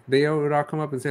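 usual speaking voice

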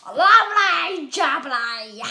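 loud slow delighted lovely jubbly; pleased exclamation. Real, not acted. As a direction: savour it with glee. Pleased after the drill.

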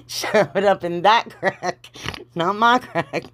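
laughing through the words. Real, not acted. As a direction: crack up at your own slip of the tongue. Cracking up at own slip.

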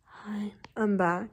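whispering with a lost voice; raspy whisper. Real, not acted. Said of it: "I have no voice".

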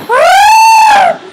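high, loud Wookiee yowl; Chewbacca roar. Shown as a demonstration. Girl showing her sister a Chewbacca sound, howling. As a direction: let out a Chewbacca howl. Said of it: "hear my Chewbacca sound".

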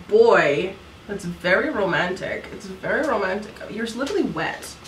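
normal talking voice

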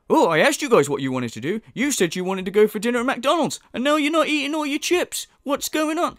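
parent scolding mock. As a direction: scold like a put-upon parent. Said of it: "It's like".

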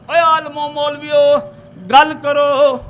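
loud, high summons to the clerics; calling out. Acted. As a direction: call out a challenge across a crowd. Acted questioner calling out the clerics.

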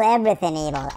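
dropped down low after the yell; low restatement. Pretend. Player repeating his threat to evil, low matter-of-fact voice.

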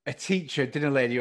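usual speaking voice

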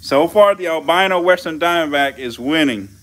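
loud sportscaster call of the leader; hyped fight announcer. Acted. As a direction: announce the winner like ringside. Announcing the snake fight score.

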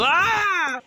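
high drawn-out mock scream; play scream. Villain cut off mid-insult, high play scream. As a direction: break off into a high pretend scream.

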